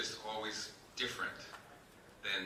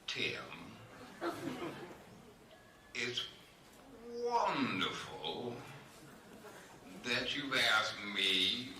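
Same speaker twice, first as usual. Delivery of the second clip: extremely slow, drawn-out Southern aristocrat drawl; comically languid Southern accent; Tim Monick impression; 3 semitones higher, slower than usual. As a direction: stretch each word out like a genteel Southern socialite, with long pauses. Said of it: "this is how this guy talked".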